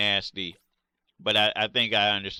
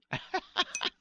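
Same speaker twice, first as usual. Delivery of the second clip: high breathy laugh; 6 dB quieter than usual. Real, not acted. Laughs at own joke.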